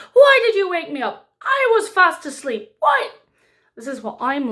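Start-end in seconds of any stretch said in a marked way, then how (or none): 0.1-3.1 much higher, louder woken-up yell; shouting. A narrator's reading. Paik shouting at his sister for waking him, loud and high. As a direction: yell like you were dragged out of sleep. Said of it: "all the king could hear was shouting".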